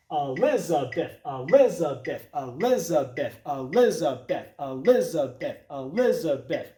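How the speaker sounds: "Elizabeth" as a quick pickup E, then accented LIZ; rhythmic pickup stress. Shown as a demonstration. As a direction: chant "Elizabeth" steadily, LIZ on the downbeat. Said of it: "we would say the name like this".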